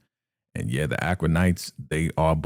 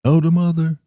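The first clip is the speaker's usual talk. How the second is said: high, loud acted call; 12 semitones higher, 7 dB louder than usual. Manny calling Elder Mother in the kitchen, high call. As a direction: call her name from across the room. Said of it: "she hears a voice".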